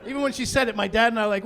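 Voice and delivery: ordinary talk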